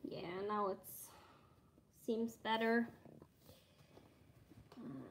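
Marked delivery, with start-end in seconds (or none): none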